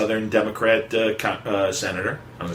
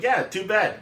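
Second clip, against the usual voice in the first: higher, lightly dismissive; mock shrug; 10 semitones higher, slower than usual. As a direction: shrug it off mockingly. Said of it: "And he's like".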